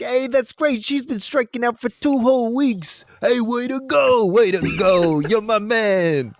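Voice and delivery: high, hammed-up Brooklyn accent, drawn out on the praise; Tony, Brooklyn accent. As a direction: cheer them on in a big Brooklyn accent. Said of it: "you did Tony".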